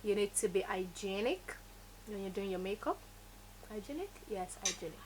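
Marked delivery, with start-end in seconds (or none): none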